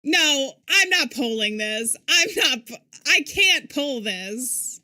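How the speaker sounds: loud, drawn-out protest; emphatic refusal. Real, not acted. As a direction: refuse loudly, stretching the words. Refusing to poll the audience.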